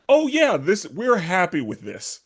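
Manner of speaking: smug. Retold or imitated. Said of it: "they're like".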